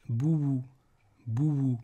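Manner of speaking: "bouou" in two parts, bou-wou, falling; not a long vowel. Shown as a demonstration. Teacher showing consonant waw, "bouou" as bou-wou. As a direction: glide through a w inside "bouou". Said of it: "c'est pas une voyelle longue".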